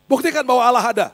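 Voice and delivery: loud, high-pitched challenge; forceful demand. Retold or imitated. Classmate demanding proof of God, loud and insistent. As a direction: demand it at full volume.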